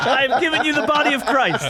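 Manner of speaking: a huffy priest, high and loud; mock priest. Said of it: "friend voices a priest".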